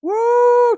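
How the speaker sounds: very high, loud woo; explosive hype whoop; loud whooping cheer. Real, not acted. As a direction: burst into a piercing whoop. Cheering for gifts.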